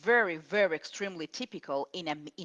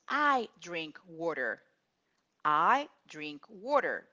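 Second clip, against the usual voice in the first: slow, clear "I drink water"; slow, deliberate "I drink water"; slow correct model. Shown as a demonstration. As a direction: say it slowly for the class. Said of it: "The correct is".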